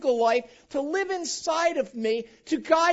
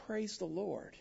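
softer, low heartfelt praise; heartfelt hush; 5 semitones lower, 14 dB quieter, slower than usual. Shown as a demonstration. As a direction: hold on tight as you say it. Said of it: "the heartfelt, holding on tight".

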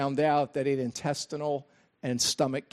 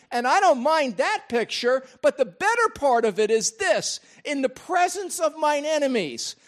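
full-force preaching; 7 semitones higher than usual. Acted. Pastor rousing the congregation, in full preaching voice. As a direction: lift the voice and drive it home to the congregation.

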